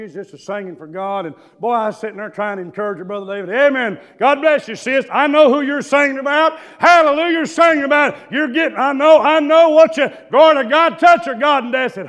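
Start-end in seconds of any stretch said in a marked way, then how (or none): 3.5-11.6 loud, higher hallelujah cheering; fervent encouragement. Retold or imitated. Young preacher cheering a church singer on, fervent shouting. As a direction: egg the singer on like a revival shouter.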